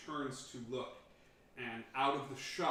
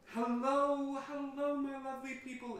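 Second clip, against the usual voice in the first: much higher, louder sing-song royal voice; regal queen greeting; 14 semitones higher, 7 dB louder than usual. Acted. Red Queen greeting the townsfolk grandly. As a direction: sweep in like a gracious queen. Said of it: "she goes".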